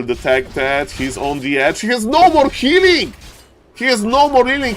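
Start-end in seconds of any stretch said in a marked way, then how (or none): 1.8-3.1 much higher, louder cry; thrilled shoutcaster; excited call. Real, not acted. Caster hype. 3.7-4.7 excited repeat. Real, not acted.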